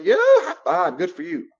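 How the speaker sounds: high, loud cheering; celebrating a friend. Shown as a demonstration. Praising a friend for saying no, loud excited cheer. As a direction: cheer them on with a high five. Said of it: "And then you celebrate".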